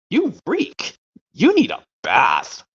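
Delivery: high, mildly disgusted put-on voice; mock disgusted owner. Owner smelling dirty Scamp, high mock disgust. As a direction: wrinkle the nose at a smelly puppy. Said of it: "how about this one where it goes".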